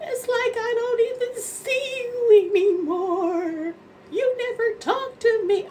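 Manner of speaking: high, loud, drawn-out wail; mock crying. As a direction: imitate a tearful whine, slow and very high. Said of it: "And she's crying".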